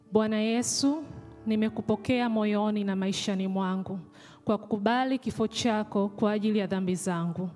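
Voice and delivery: ordinary talk, nothing marked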